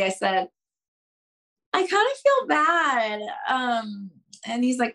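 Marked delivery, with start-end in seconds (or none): 1.7-3.2 a higher, small, bashful confession. Retold or imitated. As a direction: admit it shyly, a little embarrassed. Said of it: "a little bashfully".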